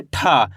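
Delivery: ठा with aspirated curled-tongue t; letter-sound demo. Shown as a demonstration. Teacher answers what we read, letter-sound demo. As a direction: puff out a long, falling ठा. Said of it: "क्या पढ़ेंगे?".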